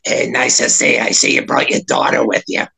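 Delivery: put-on low character voice; Bitchy. Bitchy greeting an old actor with a young woman, in character voice. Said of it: "in Bitchy's voice".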